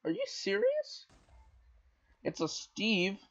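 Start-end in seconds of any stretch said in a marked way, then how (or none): 0.0-1.1 incredulous. Real, not acted. Disbelief at the game.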